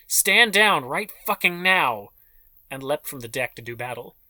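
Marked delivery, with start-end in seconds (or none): 0.1-2.1 loud, slow, higher snarled command; snarled order. A narrator's reading. Amanda Bates ordering the vampire to stand down, furious snarl. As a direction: bark it like a soldier losing control. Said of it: "Amanda Bates snarled".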